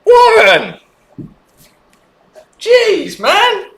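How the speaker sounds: high indignant yell; shocked shout. Real, not acted. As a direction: cry out his name, offended. Reacting loudly to insult.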